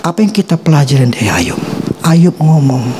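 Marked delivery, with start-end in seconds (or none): none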